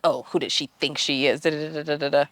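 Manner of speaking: mildly sneering inner grumble; retold petty griping under the breath; hating. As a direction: grumble resentfully, trailing into babble. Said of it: "I'm, like, behind her hating".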